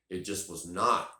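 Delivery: ordinary delivery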